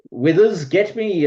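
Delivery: high, loud lordly summons; calling a servant. Acted. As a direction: call out for your servant. Lord calls out to his servant.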